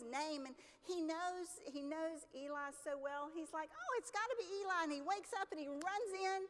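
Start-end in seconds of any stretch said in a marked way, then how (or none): 3.7-4.8 higher, quick burst of realization; hurried, higher-pitched retold exclamation; eager young boy. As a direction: lightly act a boy jumping to a conclusion. Boy wakes, mistakes voice for Eli.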